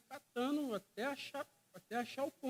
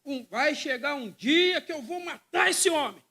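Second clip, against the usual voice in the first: mock vow of revenge; 5 semitones higher, 8 dB louder, slower than usual. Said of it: "falou assim".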